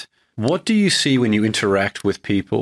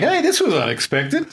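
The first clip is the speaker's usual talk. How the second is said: much higher surprised viewer voice; mock viewer. Host voicing stunned viewers, much higher and surprised. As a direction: play an amazed audience member.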